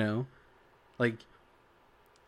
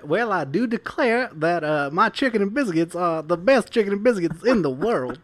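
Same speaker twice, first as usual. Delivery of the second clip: put-on higher, louder Southern gentleman accent; Colonel Sanders; 5 semitones higher, 4 dB louder than usual. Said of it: "you mean Colonel Sanders".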